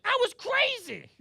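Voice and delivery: wild soul-singer yelp. Retold or imitated. [name] impression in standup bit.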